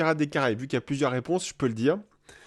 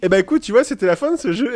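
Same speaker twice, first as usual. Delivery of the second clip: loud, raised voice, pitched high; yelling into the mic; 6 semitones higher, 6 dB louder, faster than usual. Real, not acted. Host wraps up the game, loudly and high-pitched. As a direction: belt it out into the microphone. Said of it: "Je suis en train de hurler dans le micro".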